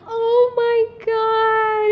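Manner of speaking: high, slow excited gasp of a mother; mother hearing the baby is coming, high and thrilled; excited mom. Retold or imitated. Excited mom voiced in story.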